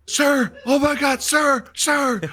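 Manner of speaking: very high, slow, loud alarmed cry; squeaky mock panic calling sir; panicked sergeant. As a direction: call for the officer in a mock panic. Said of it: "He's like".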